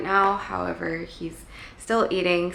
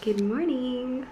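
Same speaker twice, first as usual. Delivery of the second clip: slow, high cooing good morning; 6 semitones higher, slower than usual. Real, not acted. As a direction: croon it to the kitten. Greeting kitten.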